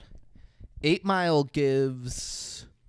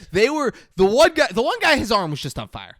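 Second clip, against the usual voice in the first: much higher and louder, amped up; can't believe what he saw, climbing in pitch; excited retelling; 5 semitones higher, 6 dB louder than usual. Real, not acted. Recapping the burning men for his co-host, excited and loud.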